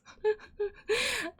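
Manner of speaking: helpless high laugh; squealing laugh; high burst of laughter. Real, not acted. Laughing at the song.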